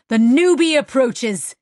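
loud, slow heralding; mock announcement. A narrator's reading. Small man heralds the new crewmate, loud and slow. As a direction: proclaim it like a grand arrival.

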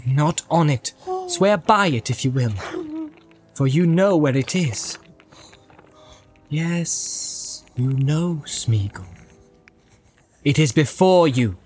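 understated stern warning; stern pity. A narrator's reading. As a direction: admonish the captive with restrained pity. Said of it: "looking down at him with stern pity".

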